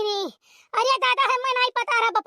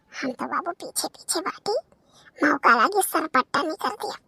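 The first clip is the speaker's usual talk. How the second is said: hushed aside; 3 semitones lower than usual. Acted. Sneaking behind in the forest.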